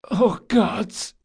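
weak, hoarse gasping groan; small hoarse voice. Acted. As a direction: croak it out barely alive. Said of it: "in a small, hoarse voice".

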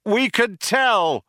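high, slow shouted retort; dry shout. A narrator's reading. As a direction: shout it back dryly. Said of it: "shouted Ford".

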